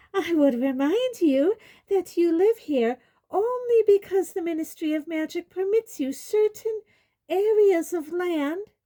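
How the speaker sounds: high, loud officious indignation; indignant. A narrator's reading. Umbridge reminding centaurs of Ministry rule, high and indignant. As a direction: lecture them imperiously in a high voice. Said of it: "with indignation".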